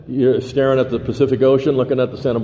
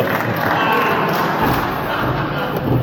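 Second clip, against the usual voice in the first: high laugh; 10 semitones higher than usual. Real, not acted. Laughs at his wife's age.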